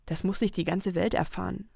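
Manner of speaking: normal talking voice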